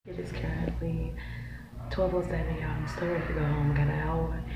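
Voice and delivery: low, very soft murmur; hushed update. Real, not acted. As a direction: talk quietly, as if not to be overheard. Murmured update from work bathroom.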